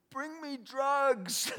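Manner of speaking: high, loud, drawn-out mock wail; hammed-up desperate demand. Addict on the phone to the pastor, mock desperate wail. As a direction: stretch it into an overacted, begging howl.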